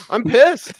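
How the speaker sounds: 2 hammed-up angry Bills fan, high and loud; 3 play a fed-up fan who's had enough; 1 mock fan rant. 4 host playing a betrayed Bills fan, mock outrage. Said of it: "I'm a Bills fan".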